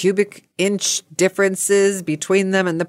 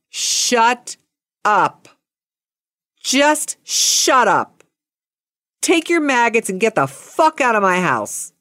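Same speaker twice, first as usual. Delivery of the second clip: mock fed-up outburst with heavy pauses; acted angry dismissal; exasperated order; 5 semitones higher than usual. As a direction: replay telling someone off, word by word.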